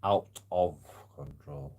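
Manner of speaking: slow, every word weighed; slow deliberate read. Retold or imitated. Reading Barry's message back slowly to him.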